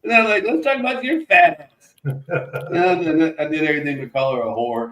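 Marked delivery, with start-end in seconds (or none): 0.4-1.9 high-pitched goading retort; mock taunt. Little brother goading his sister, high taunting voice. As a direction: needle her in a high voice.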